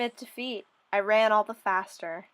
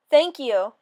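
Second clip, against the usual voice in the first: called out; 2 semitones higher, 7 dB louder than usual. Acted. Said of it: "I called".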